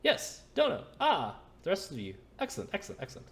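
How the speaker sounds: high, excited greeting voice; Mikhail. Acted. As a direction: exclaim high and loud, then settle slightly.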